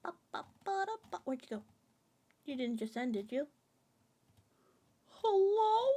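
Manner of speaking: ordinary delivery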